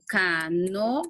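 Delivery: CANOA drawn out as ca-no-a; syllable by syllable. Shown as a demonstration. Teacher showing students a hiatus example, syllable by syllable. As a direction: split CANOA into three slow, separate syllables. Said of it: "sí se separa".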